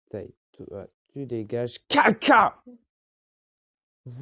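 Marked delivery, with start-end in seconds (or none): 1.9-2.6 loud, high exasperated shout; frustrated outburst. Real, not acted. Gamer shouts loudly and high in annoyance at being killed. Sudden death in the game.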